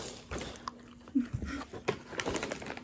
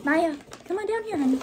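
high coaxing call; 6 semitones higher, faster than usual. Real, not acted. Calling Maya down.

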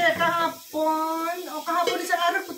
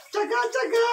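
playful urging; 8 semitones higher than usual. Real, not acted. Vlogger urging patience for tasty food, playful urging cry. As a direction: call it out high, stretching the end.

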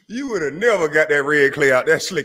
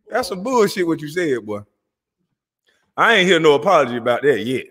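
usual speaking voice

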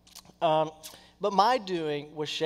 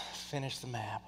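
much softer, weary after a sigh; resigned sigh; 5 semitones lower, 12 dB quieter than usual. Retold or imitated. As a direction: let it out like giving in. Tired sigh before obeying.

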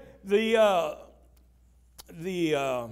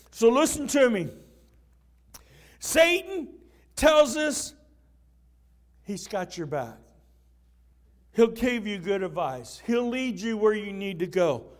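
ordinary talk, nothing marked

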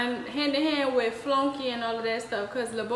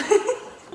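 brief high laugh burst; a quick amused laugh; short high laugh; 11 semitones higher, 4 dB louder than usual. Real, not acted. Laughs after saying poo.

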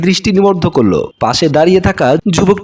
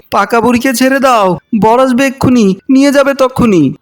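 higher-pitched insistent cry, no real shout; calling out loudly; 5 semitones higher than usual. A narrator's reading. Sister protests as uncle drags Manha away, calling out high. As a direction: raise the pitch as if calling out, volume held back. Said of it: "আয়াত জোরে জোরে বলছে".

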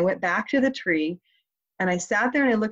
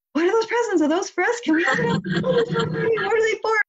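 put-on very high, louder childlike clamour; excited kids; 4 dB louder than usual. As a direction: imitate children bursting with questions. Acting out excited kids seeing presents.

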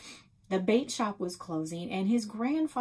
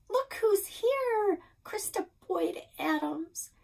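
greeting voice; 13 semitones higher, 4 dB louder, slower than usual. Acted. Nan greets her warmly.

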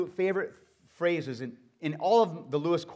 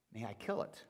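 quieter, lower solemn question; quiet angel; 6 semitones lower, 13 dB quieter than usual. Acted. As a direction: ask it gravely as the angel.

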